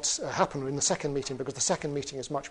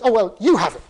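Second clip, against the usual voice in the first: loud, high, comic blurting; flustered; 7 semitones higher, 16 dB louder, faster than usual. Acted. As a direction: thrust it away in a flustered outburst. Flustered troll hastily hands baby over.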